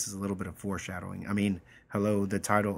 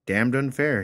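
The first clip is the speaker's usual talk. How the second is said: louder, higher mock outrage; mock indignant; 5 semitones higher, 7 dB louder than usual. Teacher reading Anders's smartass reply, mock outraged. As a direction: sound wronged, overplay it.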